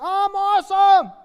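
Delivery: loud, high, drawn-out cheer; hugely upbeat shout, stretched long; exuberant reply. Acted. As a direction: belt it out with over-the-top enthusiasm. Acting out his upbeat answer to greeting.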